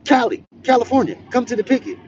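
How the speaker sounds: high, loud page over the prison loudspeaker; loudspeaker call. Retold or imitated. Guard paging him to the picket over the loudspeaker. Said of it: "All over the loudspeaker".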